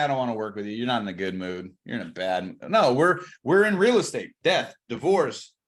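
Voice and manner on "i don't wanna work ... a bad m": low, dismissive brush-off; mock refusal. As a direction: turn the client down in a lowered mock voice. Mock agent refusing a client.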